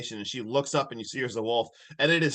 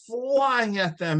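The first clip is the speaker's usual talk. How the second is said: slow, raised dramatic stretch; drawn-out excitement; 8 semitones higher, much slower than usual. Retold or imitated. Recapping the werewolf jumping out, drawn-out excitement. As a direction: stretch it out like the leap itself.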